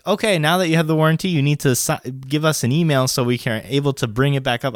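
rattled off fast, like a clerk; fast sales spiel. Retold or imitated. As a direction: rush through it like a salesperson.

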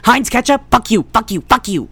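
loud, high angry cursing; angry outburst. Real, not acted. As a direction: shout it out at the screen. Ranting at a website ad.

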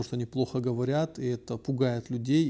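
ordinary delivery

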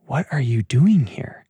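whispered, accusing question; still small voice. Pretend. Still small voice whispering to Elijah, accusing. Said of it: "the still small voice comes in with the accusation".

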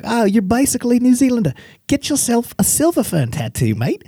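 high, friendly mock Kiwi accent; New Zealand accent. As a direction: Kiwis urging the tattoo in a hammed-up New Zealand accent. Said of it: "And they're like".

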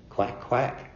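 high playful duck quack. Pretend. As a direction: answer with a silly quack. Teacher answers koan with duck call.

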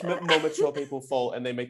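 ordinary talk, nothing marked

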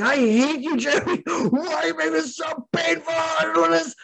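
mock furious wail, pitched very high and near crying; imitating angry scream. Man in the cold pool yelling at Jeremy, high crying mock rage. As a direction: ham up a man yelling from freezing water, high and tearful. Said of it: "And he's screaming at me".

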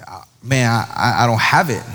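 mock-sheepish, stumbling over the words; sheepish admission. Kid admitting to the check-in guy he has no card, sheepishly.

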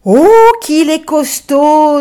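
high, loud, slow amazed cry; amazed chorus. Acted. Les enfants admirent la force de l'âne, ébahis. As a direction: marvel at his strength together. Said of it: "Dirent ensemble tous les enfants".

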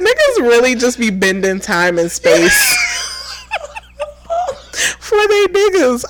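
laughing while shouting; cracking up mid-sentence. Real, not acted. Laughing joke.

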